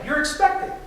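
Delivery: You're expecting said high and bright, half question half exclamation; excited declarative. Shown as a demonstration. Parent reacting to daughter's pregnancy news, excited. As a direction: blurt You're expecting as joyful announcement. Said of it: "in a very excited, declarative kind of way".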